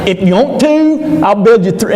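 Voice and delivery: high, country-bumpkin mock of Peter. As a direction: squeak it out like a clueless local. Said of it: "he's just Cheathaming it".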